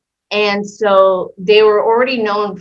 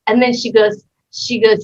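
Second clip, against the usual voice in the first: ordinary talk, nothing marked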